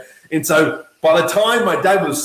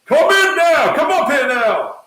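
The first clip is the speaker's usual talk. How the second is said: loud, high yell of a name; dad yelling up the staircase, loud and high; 11 semitones higher, 5 dB louder than usual. Retold or imitated. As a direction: call your son up the stairs. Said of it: "He's like".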